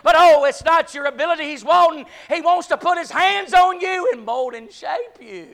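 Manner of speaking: loud, high preaching cry; fiery preaching. Acted. Preacher crying out to the church, loud and high. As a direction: preach it out to the congregation at full force.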